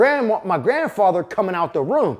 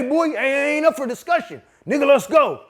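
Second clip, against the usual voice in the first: higher, firm no-arguing order; commanding grandfather; 7 semitones higher than usual. Retold or imitated. Grandpa to grandson, firm higher commanding voice. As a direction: shut the grandson down, it's settled.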